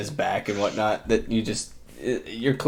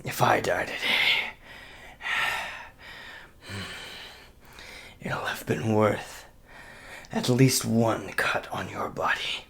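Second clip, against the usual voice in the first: grim whisper; 8 dB quieter than usual. Acted. Wounded warrior whispering his vow to the enemy. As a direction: breathe out a final oath.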